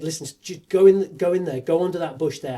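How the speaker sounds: loud, urgent directions to the crew; urgent call. Retold or imitated. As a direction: shout the orders over the storm. Director urging crew in storm.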